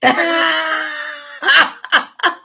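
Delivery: long, high-pitched laugh; real burst of laughter. Real, not acted. Bursts out laughing at viewer comment.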